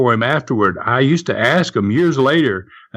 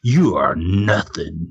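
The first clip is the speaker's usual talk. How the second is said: stern whisper-threat; 7 semitones lower than usual. Retold or imitated. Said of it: "talking into their ears very, very sternly".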